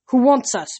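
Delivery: high, loud, insistent question; demanding. A narrator's reading. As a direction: demand an answer. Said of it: "Bianca demanded".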